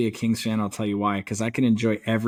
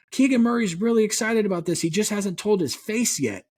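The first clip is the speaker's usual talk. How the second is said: high-pitched TV announcer impression; Mark Jones; 11 semitones higher than usual. Announcer jokes about a deadpan player, high announcer voice. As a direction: put on an excited play-by-play voice, pitched well up. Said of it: "Mark Jones ... he'll say".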